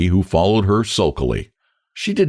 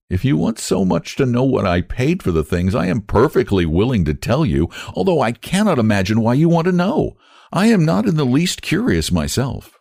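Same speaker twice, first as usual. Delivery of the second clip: Sarah voice; 7 semitones higher than usual. A narrator's reading.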